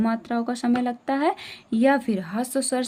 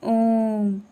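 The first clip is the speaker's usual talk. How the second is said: prolonged vowels; much slower than usual. Shown as a demonstration. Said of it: "कितना ज़्यादा समय लग रहा है ना".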